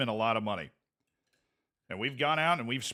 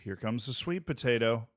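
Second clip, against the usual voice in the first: airplane feeding game. Pretend. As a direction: coax a toddler to open up. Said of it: "feeding someone with airplane game".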